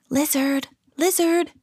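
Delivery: high, hushed calling; secretive, high-pitched call; whispered call. A narrator's reading. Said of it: "he whispered".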